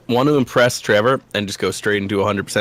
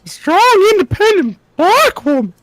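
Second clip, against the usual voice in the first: high, slow put-on diva voice; sassy high-pitched woman, drawn out; strong woman voice; 6 dB louder, slower than usual. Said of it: "This is my voice now".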